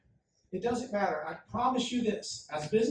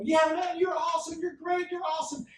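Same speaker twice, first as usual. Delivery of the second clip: high, loud cheering from the front row; shouting fan; 10 semitones higher, 4 dB louder than usual. Retold or imitated. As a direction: yell it like a starstruck fan. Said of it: "There's a guy in the front row".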